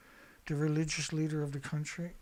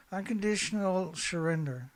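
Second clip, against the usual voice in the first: usual speaking voice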